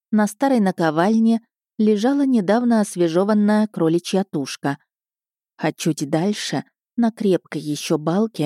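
ordinary delivery

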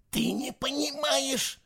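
high, hushed, slow whisper. A narrator's reading. Fisher trying to warn Eva, whispering. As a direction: whisper it slowly and urgently. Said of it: "прошептал Фишер".

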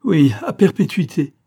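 high, loud anguished cry; agonised outburst. A narrator's reading. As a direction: cry out in horror at the memory. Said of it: "eut un cri d'angoisse".

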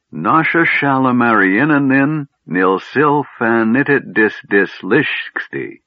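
high, drawn-out string of syllables; endless name recital. A narrator's reading. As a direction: reel off an enormous name on one breath. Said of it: "He paused to draw a breath".

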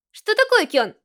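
put-on cheerful girl answering a call; Haruhi over the phone.

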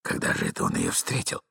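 very low, soft mumble; low young man's murmur; agitated muttering. A narrator's reading. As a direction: mutter to yourself. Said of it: "бормотал молодой человек".

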